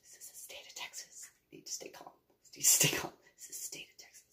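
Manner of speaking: barely audible whispered mantra; whispered self-calming. Retold or imitated. Homeowner reminding herself to stay calm, whispering. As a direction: whisper it to yourself.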